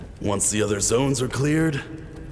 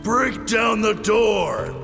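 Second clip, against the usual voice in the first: high, drawn-out command; commander's raised-voice bellow; shouted order; 11 semitones higher, slower than usual. Acted. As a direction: yell the order to your squad.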